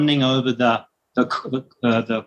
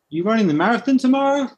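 high, quick curious question; lightly voiced clerk, pitched up; imitated store clerk; 9 semitones higher, faster than usual.

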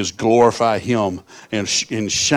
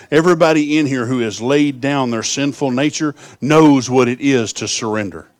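preaching; 4 semitones higher, 6 dB louder than usual. Acted. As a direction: drive every line home to the room. Preaching at full force.

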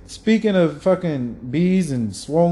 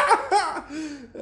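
real laugh, much higher; high burst of laughter; 13 semitones higher, 4 dB quieter than usual. Real, not acted. Laughing at friend's joke.

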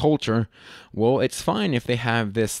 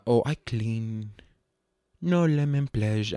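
Consuela; 3 dB quieter, slower than usual. Retold or imitated. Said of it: "without being the Consuela".

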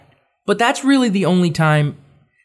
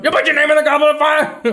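high, hammed-up accusation; comic urgent outburst; frantic mock yell; 10 semitones higher, 3 dB louder, faster than usual. Dumbledore accusing the next student, high mock yell.

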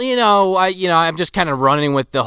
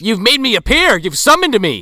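lightly put-on Irish accent; old Irishman, Irish accent; 8 semitones higher, 5 dB louder than usual. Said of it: "not that bad at an Irish accent".

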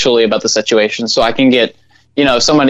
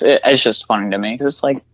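ordinary talk, nothing marked

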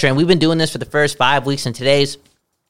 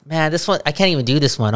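ordinary talk, nothing marked

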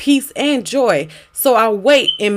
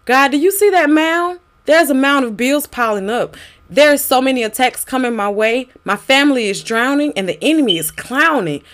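pleading prayer; 4 semitones higher than usual. Acted. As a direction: cry out to God in distress.

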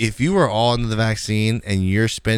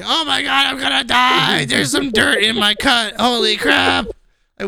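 mock dramatic whine; 12 semitones higher, slower than usual. Mocking today's kids over scrape.

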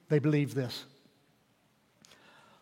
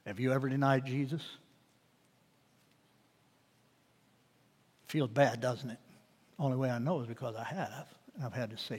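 hushed; 6 semitones lower, 12 dB quieter than usual. Real, not acted. Preacher confessing to the congregation, hushed and fading. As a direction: drop to a hushed, pleading near-whisper.

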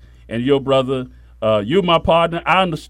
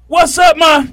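raised voice, casual cousin greeting; imitating cousin; 12 semitones higher, 7 dB louder than usual. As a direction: call out like a young cousin.

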